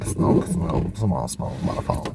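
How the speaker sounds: tiny whisper for something small. Pretend. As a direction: shrink the voice to a whisper. Stressing how small it was.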